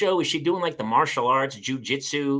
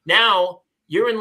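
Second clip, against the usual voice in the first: ordinary delivery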